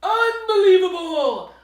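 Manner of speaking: high, loud exasperated roar; rumbling bear. Acted. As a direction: let the disbelief boom out. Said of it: "rumbled Bear".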